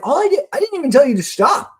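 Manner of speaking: high-pitched retold protest to a child; pleading parent. Parent retells pleading with crying child.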